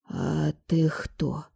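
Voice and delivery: soft, very slow stunned question; amazed. A narrator's reading. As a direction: drag it out, dumbfounded. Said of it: "изумлённо вытаращилась на неё соседка". (изумлённо)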